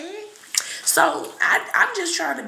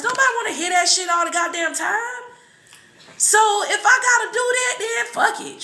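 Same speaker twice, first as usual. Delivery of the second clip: heated rant; 11 semitones higher, 3 dB louder than usual. Real, not acted. Ranting about people.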